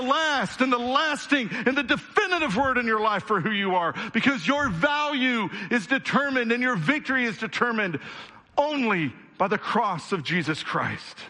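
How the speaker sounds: preacher swept up in his own conviction; worked up. Real, not acted. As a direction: build the passion up line by line. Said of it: "I get a little worked up".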